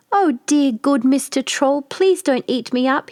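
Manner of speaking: a little goat begging the troll to spare him; frightened cry. A narrator's reading. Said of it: "he cried".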